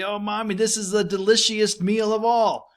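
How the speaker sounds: put-on high-pitched gushing kid voice; child complimenting mommy. Kid praising mommy's cooking, in a high excited voice. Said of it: "You might compliment your auntie or your mommy".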